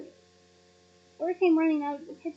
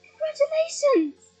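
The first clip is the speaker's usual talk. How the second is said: high, slow, louder squeal; drawn-out high squealed cheer; squealed congratulations; 11 semitones higher, 6 dB louder, slower than usual. Acted. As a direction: squeal with delight. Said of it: "both squealed".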